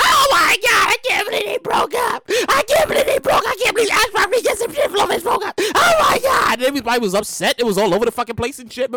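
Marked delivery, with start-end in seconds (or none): 0.0-6.5 mock shocked fan. Imitating fans shocked by the breakup, high shrieks. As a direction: squeal it like stunned gossiping fans. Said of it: "everybody was talking about".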